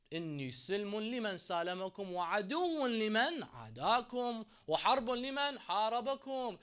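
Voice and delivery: loud, high preaching recitation; fervent declaration. Real, not acted. Preacher pledging enmity to the Imams' enemies, fervent proclamation. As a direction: proclaim the pledge with rising force.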